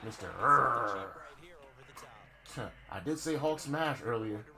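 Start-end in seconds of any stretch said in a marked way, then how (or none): 0.1-1.1 low, slow, drawn-out growl; mock tough guy.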